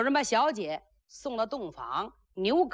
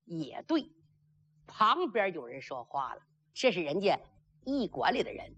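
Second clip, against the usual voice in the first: ordinary delivery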